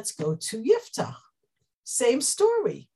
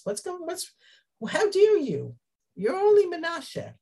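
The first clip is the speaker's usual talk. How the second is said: higher, outraged how dare you; indignant outrage; 7 semitones higher than usual. Retold or imitated. Ephraim's men scoffing at a Menashe leader, outraged. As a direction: sputter with offended pride. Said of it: "jealousy and arrogance".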